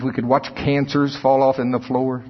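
ordinary delivery

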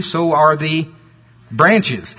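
pause, then "branches" on its own; "branches" set apart with emphasis; stress on branches. Shown as a demonstration. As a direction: hold the listener a beat before stressing "branches". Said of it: "gonna emphasize the word branch".

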